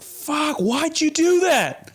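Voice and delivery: high-pitched mock yell at himself; retold car yelling. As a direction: replay the frustrated yell at the windshield. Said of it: "just yelling at the windshield".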